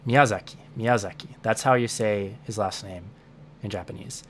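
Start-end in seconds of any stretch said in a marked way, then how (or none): 0.1-1.3 Miyazaki with a light English stress on ya; slight English stress. Shown as a demonstration. Teacher shows the Japanese name with a slight English stress. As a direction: lean a little on the ya of Miyazaki. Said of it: "I was giving it a little bit of English stress".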